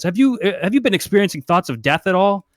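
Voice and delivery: mildly pompous doctor inquiry; doctor asking. Acted. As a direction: probe the patient briskly. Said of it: "he says".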